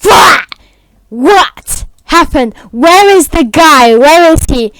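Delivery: loud, slow, high-pitched demand; shocked giants. Acted. Giants find the guy missing, loud shocked demand. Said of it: "They're like".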